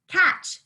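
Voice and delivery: high, loud "Catch" with a sharp tch at the end; crisp catch demo. Shown as a demonstration. Teacher models "Catch" for third graders, bright and loud. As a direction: snap out "Catch" so the final tch pops. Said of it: "catch with a T C H".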